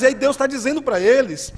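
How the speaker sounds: usual speaking voice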